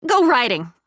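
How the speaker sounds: ordinary delivery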